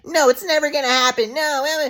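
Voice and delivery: high, dismissive mom voice; mocking mom. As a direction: brush him off like a cold mother. Mom's refusal acted out.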